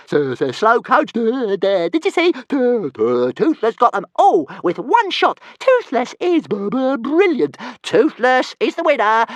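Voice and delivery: a tiny dragon crowing about his win; boasting little dragon. Acted.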